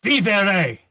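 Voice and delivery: the headmaster yells to the crowd. Acted. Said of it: "he yells again".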